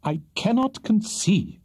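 high, slow, exasperated bafflement; flustered gentleman at a loss; bewildered. A narrator's reading. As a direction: protest in drawn-out bewilderment. Pickwick bewildered by the widow's behaviour.

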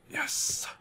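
hushed little cheer; whispered yes. Real, not acted. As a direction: whisper it in triumph. Quiet triumph in game.

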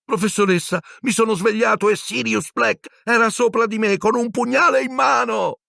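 very high frantic shouting voice; frantic boy. Acted. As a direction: plead desperately. Ron pleading, terrified.

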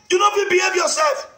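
high, fast yelled threat; shouted warning. Retold or imitated. Ranting at Mori online, shouting the warning at him.

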